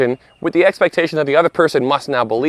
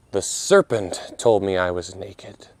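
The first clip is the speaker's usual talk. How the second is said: serpent named slow and soft; slow, soft reply; 6 semitones lower, 5 dB quieter, slower than usual. Retold or imitated. Adam blaming the serpent, slow and hushed. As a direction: drag it out, dropping to a hush. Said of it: "Option number four".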